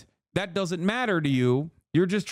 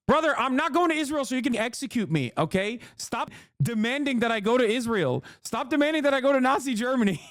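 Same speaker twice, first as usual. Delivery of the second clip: high-pitched, worked-up protest; exasperated; 7 semitones higher than usual. Real, not acted. Streamer refusing a chatter's demands to go, exasperated. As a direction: push back in exasperation, pitch climbing high.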